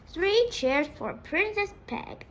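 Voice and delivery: shouted cheer. A narrator's reading. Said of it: "shouted Fred".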